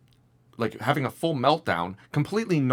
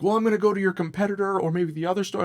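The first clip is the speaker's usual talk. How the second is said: higher, hurried mock complaint; retold customer meltdown; 9 semitones higher, faster than usual. Upset customer threatening to take her business elsewhere, retold meltdown. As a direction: rattle it off high, like an upset customer. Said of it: "having a meltdown".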